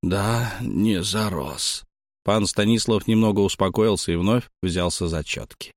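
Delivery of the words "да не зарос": calm him down gently, sweet as honey; calm and warm. A narrator's reading. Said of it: "спокойным, тёплым, как мёд". (спокойно и тепло, как мёд)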